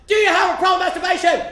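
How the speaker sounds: high, loud demanding shout; top-of-the-lungs yell. Retold or imitated. Church lady gripping a boy's head, yelling high and loud. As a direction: bellow it at full volume. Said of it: "yells at the top of her lungs".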